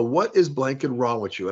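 ordinary delivery

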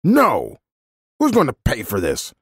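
angry threats. A narrator's reading. Man threatening a child on his property, angry. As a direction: threaten the little trespasser in a temper.

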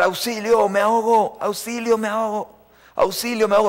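playing the panicked voice of someone drowning; mock cry for help. Preacher acts out a passenger crying help from the water. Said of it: "alguien empezó a gritar".

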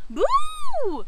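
very high, loud shriek; Sebastian. A narrator's reading. Ghost pupil copying the principal, very high shriek. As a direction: shriek a long ghostly boo. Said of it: "Sebastian shrieked".

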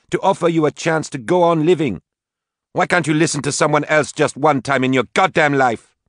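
high, loud, exasperated; angry. Acted. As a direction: yell your frustration at her. Daughter furious at mother.

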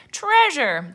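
high, loud crew cry; pirates' echo. A narrator's reading. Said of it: "the pirates repeated".

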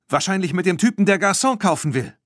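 hoch und lebhaft, noch ganz aufgeregt. A narrator's reading. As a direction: aufgeregt weitererzählen. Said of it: "sagte sie aufgeregt". (Bibi zu den Freundinnen, aufgeregt)

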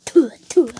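loud, airy spit noises; mock spitting. Painter spitting on his paintings, loud mock spits. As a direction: spit twice, sharply. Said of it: "I spit on my paintings".

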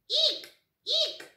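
high-pitched "eek" squeaks; short high squeal; loud squeak. Acted. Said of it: "It's a very loud squeak".

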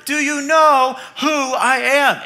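a hammed-up celebrity bellowing at the cashier. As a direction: push the star's outrage a notch further. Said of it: "he yells even louder".

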